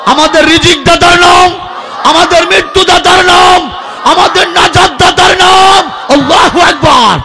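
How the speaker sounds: call-and-response, rising to a shout; building call-out. Real, not acted. Preacher calling out to crowd.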